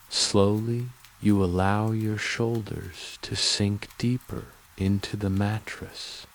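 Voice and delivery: usual speaking voice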